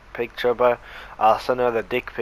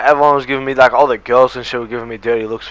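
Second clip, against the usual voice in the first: ordinary delivery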